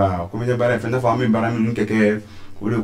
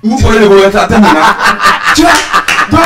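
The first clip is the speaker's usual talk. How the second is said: excited comic shouting; 9 semitones higher, 7 dB louder, faster than usual. Acted. Comedian acting out restaurant sketch.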